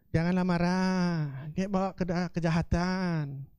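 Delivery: slow calm recitation of the verse; calm scripture reading. Shown as a demonstration. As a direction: let each word of the verse settle. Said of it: "Firman Tuhan coba bawanya kayak gitu".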